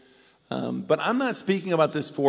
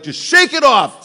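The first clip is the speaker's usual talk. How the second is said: much higher, louder, dismissive; bad dad advice; 11 semitones higher, 13 dB louder than usual. Dad telling a hurt kid to shake it off, dismissive. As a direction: brush it off like a tough dad. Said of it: "that really bad".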